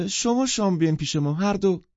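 high, loud woman's invitation; heated enthusiasm. A narrator's reading. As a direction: urge them warmly and eagerly to come. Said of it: "زن با حرارت گفت".

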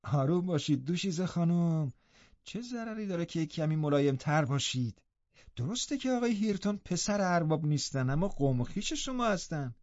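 quiet, placating servant woman; maidservant. A narrator's reading. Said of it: "زن خدمتکار گفت".

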